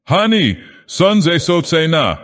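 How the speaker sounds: sudden high, loud outburst; a high, loud cry of rejection. Acted. Savage blurts his five words.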